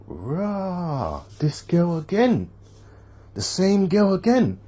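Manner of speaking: high, stretched-out exclamation; re-enacted disbelief. Man recognises the same girl years later, re-enacted amazement. His reaction on recognising her.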